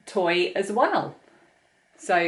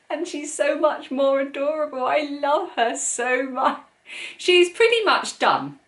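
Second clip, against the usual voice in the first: gushing; 8 semitones higher, 4 dB louder than usual. Real, not acted. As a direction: coo over her like a beloved pet. Gushing over finished triceratops toy.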